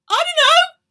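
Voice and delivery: very high, loud squeak; high guilty child squeak; squeaky denial. Acted. Child caught again.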